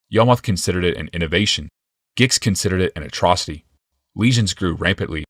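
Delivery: usual speaking voice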